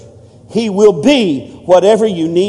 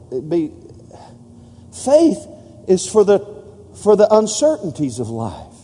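ordinary delivery

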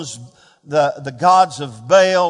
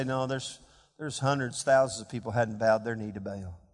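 much softer and lower, the gentle voice of God; the quiet voice of God answering a weary prophet; still small voice; 11 semitones lower, 12 dB quieter than usual. Acted. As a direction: speak it barely above a breath, tender and sure. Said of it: "a still small voice came".

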